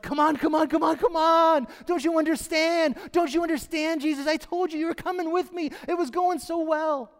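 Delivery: urgent and frantic, pushing Jesus; a frightened dad hurrying the teacher; panicked father. Retold or imitated. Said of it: "I'm sure Jairus was freaking out".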